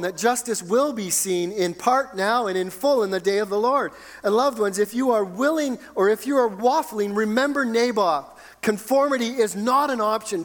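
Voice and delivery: high, emphatic pulpit exhortation; fervent preaching. Real, not acted. Preaches at full force.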